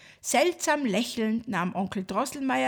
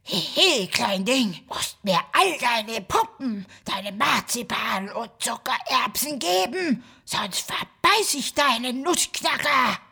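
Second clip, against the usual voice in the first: mustache twirling villain. Acted. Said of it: "flüsterte".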